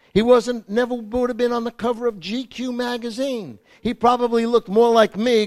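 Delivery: fervent loud sermon delivery; preaching at full force. Acted. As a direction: preach it with fire.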